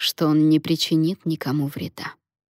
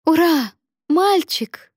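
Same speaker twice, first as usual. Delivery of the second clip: very high, jubilant cry; joyful shout; 11 semitones higher, 5 dB louder, slower than usual. A narrator's reading. Mary announces the newborn boy, shouting joyfully. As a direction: cheer out loud in triumph. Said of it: "крикнула Мэри".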